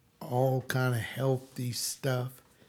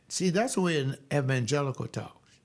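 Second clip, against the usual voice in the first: usual speaking voice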